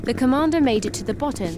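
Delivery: ordinary delivery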